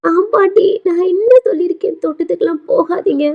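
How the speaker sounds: acted high-pitched, upset complaint; tearful reproach. Crying grandson to injured grandmother about the garden, tearful reproach. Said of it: "அழுகுறான் பாட்டியை ஹக் பண்ணிக்கிட்டு".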